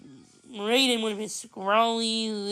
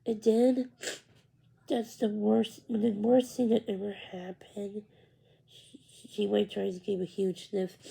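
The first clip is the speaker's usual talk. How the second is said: tearful; 3 dB quieter than usual. Acted. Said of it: "there were tears in her eyes".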